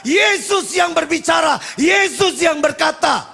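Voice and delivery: high, loud declamatory shout; full-force preaching. Real, not acted. Preacher declares to congregation that Jesus has spoken, shouted. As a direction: proclaim it at the top of your voice.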